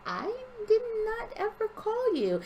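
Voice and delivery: high-pitched protest, acted out; retold indignant denial. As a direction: pitch it way up in mock disbelief.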